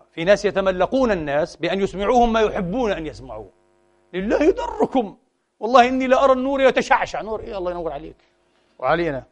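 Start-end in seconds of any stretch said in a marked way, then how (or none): 4.1-7.2 a hammed-up sycophant gushing; mock flatterer. Mocking flatterers who praise listeners. (متملق متصنع ببطء)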